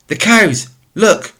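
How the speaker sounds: high, loud warning cry; alarmed yell. Acted. Hailu yelling to the boys about the cows, loud alarm. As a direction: shout to the others that the cows are loose. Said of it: "Hailu let out a yell".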